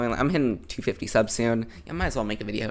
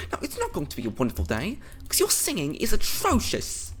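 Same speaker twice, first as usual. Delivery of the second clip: hammed-up bad British accent; horrible British accent; 7 semitones higher than usual. Annoyed teacher scolding bad singers, in a hammed-up British accent. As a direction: do a deliberately awful British accent, stretching out atrocious. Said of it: "I'm going for all the horrible accents right here".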